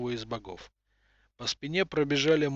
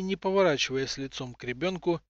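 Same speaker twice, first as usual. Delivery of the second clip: plain reading voice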